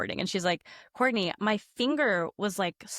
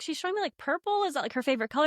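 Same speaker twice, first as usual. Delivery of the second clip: puzzled questioning; 7 semitones higher, faster than usual. Retold or imitated. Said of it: "I was like".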